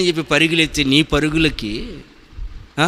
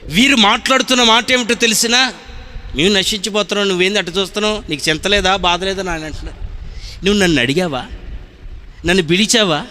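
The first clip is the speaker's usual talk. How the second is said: fiery preaching; 8 semitones higher than usual. Acted. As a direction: preach it with full force to the congregation. Preaching at full force.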